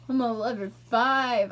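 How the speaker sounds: ordinary talk, nothing marked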